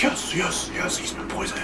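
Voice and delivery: very soft, mock-secretive whisper; conspiratorial whisper. Plotters gloating over the poisoning, hushed whisper. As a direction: whisper the aside under your breath.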